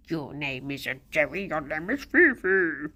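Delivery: low voice correcting a pet; low pet talk. Real, not acted. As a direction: drop low to address the animal. Addressing pet after calling it.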